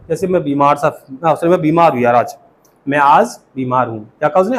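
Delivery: ordinary delivery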